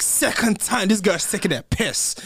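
loud, high incredulous rant; worked-up, indignant retelling; retold outrage. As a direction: exclaim it slowly, voice way up. Retells anger at public embarrassment.

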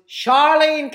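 loud call of a name up the house. Retold or imitated. Dad calls his daughter to the door, loud. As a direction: shout the daughter's name to come out. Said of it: "and he goes".

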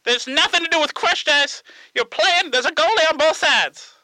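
high, comic put-on voice; Tony Zamboni. Tony explaining hockey to the callers, high character voice. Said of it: "you're gonna have to explain".

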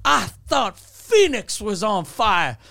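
slow, drawn-out shout to the crowd; imitated MC's big rallying yell; hype MC. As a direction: bellow it like an over-eager emcee. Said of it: "and he goes".

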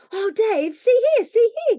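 put-on high, loud excited boy; intense excitement. A narrator's reading. Joel shows Dave his find, intensely excited. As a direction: cry out high and loud, bursting to show it. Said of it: "he cried in intense excitement".

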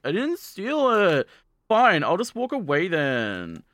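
slow, high, sulky protest, dragged out; hammed-up hurt innocence; huffy denial. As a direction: whine it and stalk off. Retold denial, storming off.

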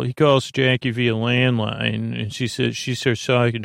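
normal talking voice

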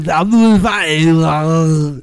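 very slow, loud strangled groan; hammed-up dying howl, words stretched and slurred; Arnold Schwarzenegger. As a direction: gasp it out like suffocating on Mars. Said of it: "No, he's on Total Recall".

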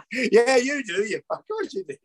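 laughing. Real, not acted. Laughing along at the fish finger joke. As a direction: giggle through the words.

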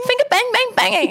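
high, laughing mock bloke voice, hammed up; mock boorish bloke. Mocking what the bouncer heard, hammed-up high bloke voice. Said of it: "that's what he's hearing".

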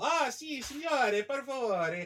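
high, sing-song mock Italian accent. Italians fawning over an American, in mock Italian accent. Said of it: "they're just smiling, go".

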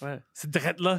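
lightly imitating the teacher's "C'est drette là"; mock joual teacher. Mocking the teacher's joual to the host, lightly imitated. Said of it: "surtout que c'est une prof".

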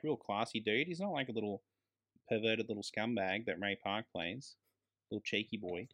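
normal talking voice

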